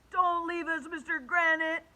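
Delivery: put-on high, loud child's plea; begging. A narrator's reading. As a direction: beg loudly like a desperate kid. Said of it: "begged Alexia".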